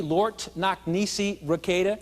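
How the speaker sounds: slow, spelled-out fake Latin; slow call to repeat. Shown as a demonstration. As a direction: lead the room through it, slowly. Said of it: "Would you say it with me?".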